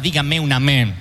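usual speaking voice

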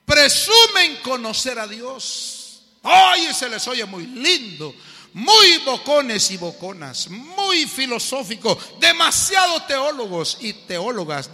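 loud, slow, emphatic preaching; preaching at full force. Acted. Preacher driving home the lesson to the congregation, loud and slow. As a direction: hammer the point home to the congregation.

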